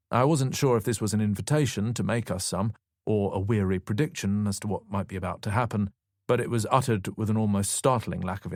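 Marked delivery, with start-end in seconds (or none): none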